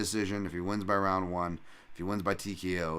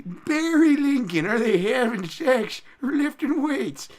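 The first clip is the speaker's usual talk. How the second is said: very slow, much higher, stretched-out name call; ring announcer; 9 dB louder, slower than usual. Pretend. Streamer calls out the fighter like a ring announcer.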